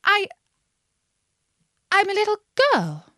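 high, hesitant little voice; doubtful. A narrator's reading. Alice remembering her many changes, doubtful. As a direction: answer haltingly, unsure of it yourself. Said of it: "rather doubtfully".

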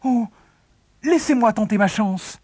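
put-on high, begging kid voice; pleading. A narrator's reading. Youngest begging his family for a chance, pleading. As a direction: plead like an eager little boy. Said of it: "supplia le gamin".

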